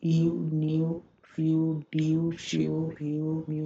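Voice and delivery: New, few, due drawn out one by one; teacher reading each word slowly for students; slow word drill. Shown as a demonstration. Teacher to students, listing words slowly. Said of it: "अब ये देखिए".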